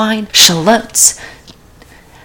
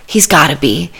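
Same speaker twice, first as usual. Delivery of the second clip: usual speaking voice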